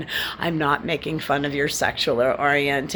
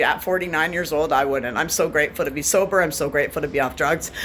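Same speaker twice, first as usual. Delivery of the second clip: ordinary talk, nothing marked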